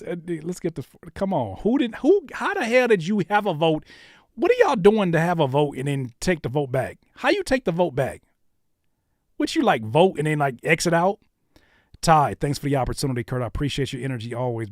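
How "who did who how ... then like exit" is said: incredulous, loud, raised-pitch questioning; loud disbelief. Real, not acted. Host challenging voters who took a vote back, incredulous. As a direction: demand an explanation, baffled and loud. Baffled complaint about withdrawn vote.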